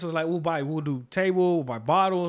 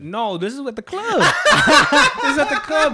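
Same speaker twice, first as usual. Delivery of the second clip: high laugh, then louder and higher; laughing correction; 8 semitones higher, 8 dB louder than usual. Real, not acted. Correcting friends who confused his knee stories, laughing. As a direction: laugh it out and set them straight.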